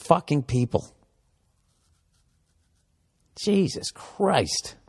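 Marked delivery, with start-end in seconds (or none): 3.4-4.8 slow, drawn-out exclamation; stunned awe. Real, not acted. Comic reacting to the population, slow awe. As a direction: let the number sink in slowly.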